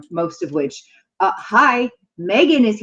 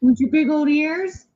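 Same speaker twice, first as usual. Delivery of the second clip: higher playful pet voice; 7 semitones higher than usual. Real, not acted. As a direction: tease the dog sweetly. Talking to the dog.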